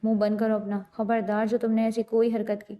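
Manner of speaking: plain reading voice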